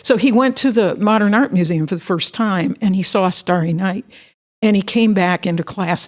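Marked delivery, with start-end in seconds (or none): none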